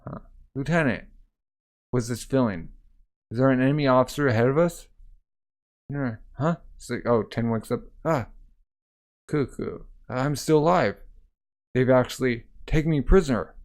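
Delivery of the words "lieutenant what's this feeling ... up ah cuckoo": ordinary delivery, nothing marked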